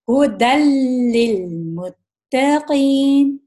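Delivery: للمتقین drawn out slowly, noon fully merged; complete idgham. Shown as a demonstration. Teacher recites correct merge again, slowly. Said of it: "یہ پورا complete ادغام ہو گیا".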